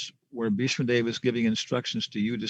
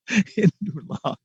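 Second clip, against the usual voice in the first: higher, slow, chuckling disbelief; amused repeat; 8 semitones higher, 3 dB louder, much slower than usual. Real, not acted. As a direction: savor the absurdity, laughing. Laughing repeat of the tiny shelf.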